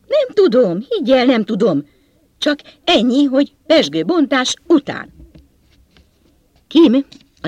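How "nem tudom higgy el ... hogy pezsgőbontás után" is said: high, loud, insistent answer; agitated protest. A narrator's reading. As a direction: protest at a raised pitch that you don't know. (izgatottan, emelt hangon bizonygatva)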